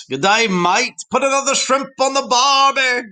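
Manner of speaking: high, loud mock Australian accent; bad Australian accent. Teacher showing off a favourite accent, badly faked Australian. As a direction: put on a hammy Australian accent. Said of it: "admired with the British and Australian accent".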